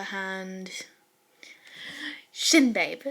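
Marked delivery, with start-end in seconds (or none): none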